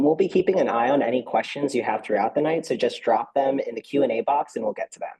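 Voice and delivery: ordinary talk, nothing marked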